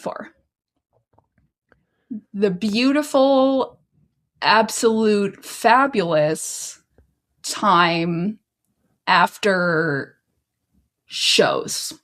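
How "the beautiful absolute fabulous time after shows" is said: each word drawn out slowly, playfully; slow mock expansion. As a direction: spell out the acronym slowly like a grand reveal.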